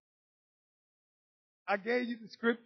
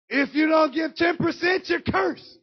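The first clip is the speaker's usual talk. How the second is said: mock preacher threat; 5 semitones higher, 8 dB louder than usual. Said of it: "and saying".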